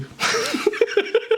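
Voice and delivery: high giggle; real, high-pitched burst of laughing; amused laughter. Real, not acted. Streamer reacting to the clip, amused laughter.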